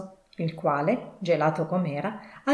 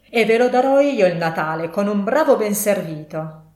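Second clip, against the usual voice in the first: loud, sarcastic warning; angry threat; 3 semitones higher, 7 dB louder than usual. A narrator's reading. Scrooge threatens his clerk with dismissal, loudly irritated. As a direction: threaten the sack, loud and stern.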